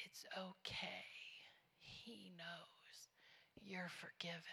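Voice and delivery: barely audible, spaced-out reassurance; tender whisper. Retold or imitated. Church girl answering the woman's confession, tender whisper of forgiveness. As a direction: whisper absolution gently, pausing after each phrase.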